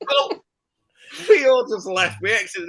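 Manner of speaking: high-pitched words through real laughter; laughing. Real, not acted. Narrator recalls the naked jump, laughing loudly. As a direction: laugh it out, barely holding on.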